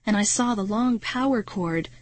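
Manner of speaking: plain reading voice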